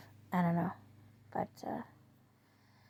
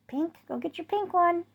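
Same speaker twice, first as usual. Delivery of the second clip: very high, bright command to a dog; talking to the dog; 9 semitones higher than usual. Retold or imitated. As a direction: send the dog off, pitched way up. Sending the dog to fetch.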